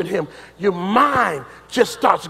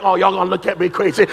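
usual speaking voice

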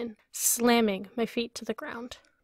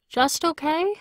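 raised higher, called out; calling after her; 6 semitones higher, 3 dB louder than usual. A narrator's reading. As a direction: call it after her, puzzled. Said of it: "I call after her".

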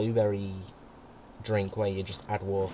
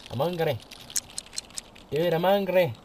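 put-on childish hungry plea; whiny hungry sim; 7 semitones higher than usual. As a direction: beg for food like a hungry kid. Voicing hungry sim character.

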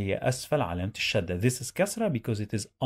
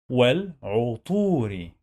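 sounded out. Shown as a demonstration. Teacher drilling learners on reading, sounded-out والعطور. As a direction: break والعطور into wal, 'u, long tuu, ri.

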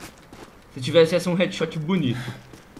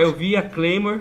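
usual speaking voice

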